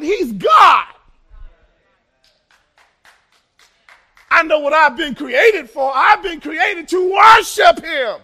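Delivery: loud, building sermon cadence; preaching at full force. Real, not acted. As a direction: drive it home to the church. Preaching to congregation.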